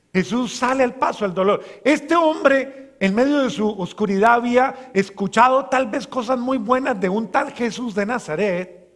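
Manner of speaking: loud, impassioned sermon voice; fervent preaching. Acted. Preacher rousing the congregation with a loud, fervent appeal.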